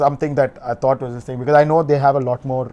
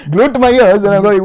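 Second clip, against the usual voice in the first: usual speaking voice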